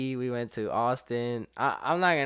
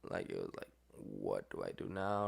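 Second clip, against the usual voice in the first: panicked gasp; 14 semitones lower, 9 dB quieter than usual. Retold or imitated. Panicking over missing friend.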